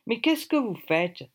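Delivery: attempted English accent in French; French spoken with a hammed-up English accent; thick English accent. A narrator's reading. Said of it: "un accent anglish à couper au couteau".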